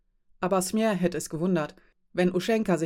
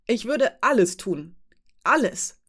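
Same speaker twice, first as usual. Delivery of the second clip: slow, stress on alles, the last Alles much higher; desperate insistence; 3 semitones higher, 4 dB louder than usual. Acted. Mother swearing she would do anything, desperate. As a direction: lean hard on alles, then cry Alles higher.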